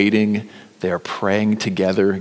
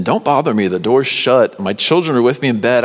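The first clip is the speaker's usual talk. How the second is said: louder, higher, irritated refusal; annoyed neighbour; 4 semitones higher, 6 dB louder than usual. Acted. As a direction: snap him off from bed. Neighbour refuses from his bed.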